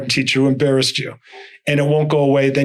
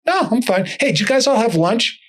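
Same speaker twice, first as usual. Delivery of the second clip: breezy, higher-pitched brush-off; retold unworried cool; 8 semitones higher than usual. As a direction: act carefree, joking with the surgeons. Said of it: "you look cool as a cucumber".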